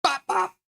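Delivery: high cartoon "bop bop"; squeaky punch sounds; punch sound effect. Shown as a demonstration. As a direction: make punching noises. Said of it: "I punch like this".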